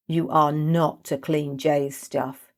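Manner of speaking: slow, every word weighed; firm slow order. Acted. Niz forbidding Kesa to clean, slow and firm. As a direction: lay down the law slowly.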